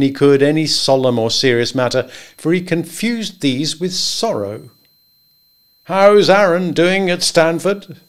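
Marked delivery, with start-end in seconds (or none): 5.8-8.0 higher, louder, bluff and jovial; higher voiced good old boy; hearty Henry. A narrator's reading. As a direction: boom friendly advice across the desk. Henry changing subject.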